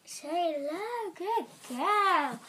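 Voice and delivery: high sing-song pet talk; talking to dog. Real, not acted.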